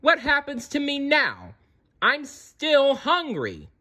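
high-pitched, indignant complaint; angry whining. A narrator's reading. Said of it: "Sarah looked around angrily".

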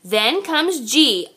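plain reading voice

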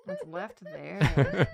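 slow, loud, laughing; slow laughing repeat. Pretend. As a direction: drag it out through a laugh. Repeats joke line slowly.

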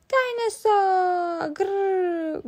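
put-on high toddler voice, long drawn out; happy growl. A narrator's reading. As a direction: stretch the word slowly into a playful growl. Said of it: "growled George happily".

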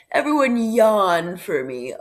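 a light yawning call to the class; playful mock instruction to the class; teacher asks the class to yawn; playful teacher request. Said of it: "I'm sure we would say".

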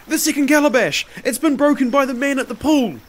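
high, loud, panicked report; gasping. A narrator's reading. Slave reports second breakage to master, gasping alarm. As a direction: blurt it out in alarm. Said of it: "he gasped".

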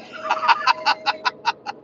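real high-pitched burst of laughter; long, high laughing fit. Real, not acted. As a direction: crack up laughing, high and unvoiced. Laughing at the ride question.

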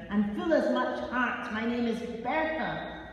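a touch of a shout at the officer; understated loud insistence. Acted. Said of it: "she was shouting at the immigration officer".

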